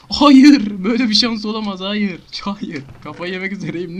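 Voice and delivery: high, loud outraged yell; disbelieving shout. Real, not acted. Gamer shouting at the game in disbelief. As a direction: scream at the bad luck.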